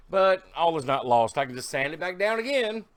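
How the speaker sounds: normal talking voice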